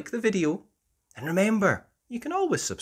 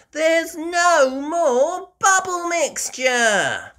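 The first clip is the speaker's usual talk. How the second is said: high, slow, louder dismayed voice; Peppa dismayed; 8 dB louder, slower than usual. Acted. Peppa telling everyone it's gone, high dismay. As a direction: wail the bad news.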